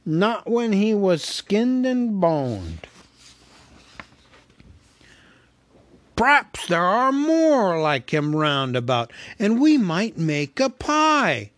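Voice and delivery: loud, slow scheming troll. A narrator's reading. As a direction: plot dinner like a troll. Trolls plan cooking.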